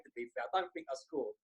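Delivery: ordinary talk, nothing marked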